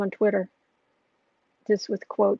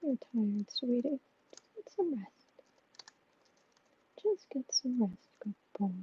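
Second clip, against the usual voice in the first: whisper; 10 dB quieter than usual. Real, not acted. Owner whispering to her tired cat to rest, soft whisper. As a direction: whisper him gently to sleep. Soothing pet to sleep.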